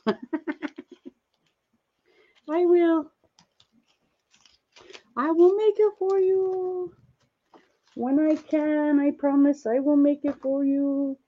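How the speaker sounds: put-on high playful baby-talk promise, slowing at the end; cutesy voice. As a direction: coo it like to a baby.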